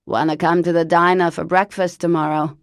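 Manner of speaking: loud, put-on voice of an old chain smoker; Lavinia's horrible voice. Cousin Lavinia phoning Parker, loud put-on smoker's voice. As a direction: bark it out like a lifelong smoker. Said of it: "Lavinia suggested".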